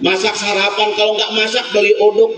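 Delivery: ordinary talk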